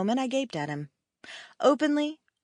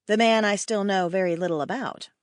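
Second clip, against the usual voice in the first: ordinary delivery, nothing marked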